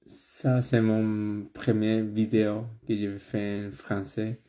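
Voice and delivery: non-native French, careful and hesitant; foreign accent in french. Real, not acted. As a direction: speak French as a learner from abroad. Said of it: "j'ai pas une bonne prononciation".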